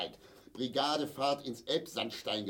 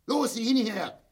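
angry demand; 10 semitones higher, 6 dB louder than usual. Acted. Father in pain angrily ordering his wife to look, furious shout. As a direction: bark it high and loud.

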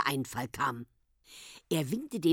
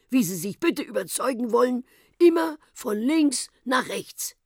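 higher, pompous lecturing scholar; proud professor; 5 semitones higher than usual. Acted. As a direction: presents his experiment with pride. Professor voiced in story. (stolzer, dozierender Professor)

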